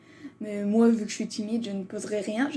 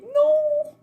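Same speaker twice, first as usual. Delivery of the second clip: a sudden, much higher and louder wail of "Non!"; mock anguished cry; 4 dB louder than usual. Saying sorry to Chuck for picking him, with a hammed-up wail. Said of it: "Limite, je vais chialer".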